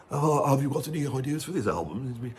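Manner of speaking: put-on plummy, upper-class English accent; posh English accent. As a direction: say it like a well-spoken English gentleman, plummy and refined. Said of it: "they don't talk like that".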